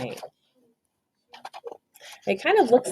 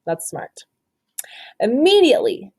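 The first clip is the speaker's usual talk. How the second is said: ordinary delivery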